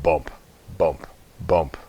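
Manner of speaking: ordinary delivery, nothing marked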